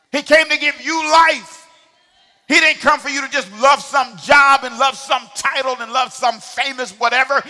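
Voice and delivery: shouted, high-pitched sermon climax; rally the congregation with passion, drawing out key words; loud, impassioned call-and-response preaching; full-force preaching. Real, not acted.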